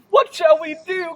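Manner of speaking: high, loud panicked question; frightened cry. Acted. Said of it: "cried S".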